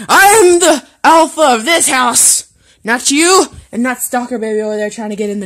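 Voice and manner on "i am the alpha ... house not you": loud, high declaration of dominance; big indignant proclamation; commanding shout. Real, not acted. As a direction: declare yourself boss at full voice. Asserting dominance over the dog.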